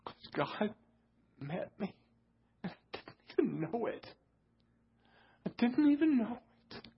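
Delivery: small choked voice lifting high; overcome, tearful. Real, not acted. Preacher telling church God met him unawares, tearfully overcome. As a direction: testify through tears, in broken phrases.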